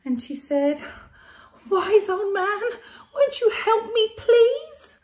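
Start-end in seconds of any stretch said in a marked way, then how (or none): 1.7-5.0 high, loud, crying plea; desperate pleading. Acted. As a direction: beg the wise man, close to tears. Old lady pleading.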